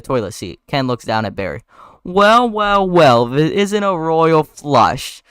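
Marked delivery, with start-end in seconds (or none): 2.0-5.2 slow, loud, high gloat; hammed-up villain taunt; villainous gloating. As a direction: savour the threat, stretching it out. Ken gloats over trapped Barry.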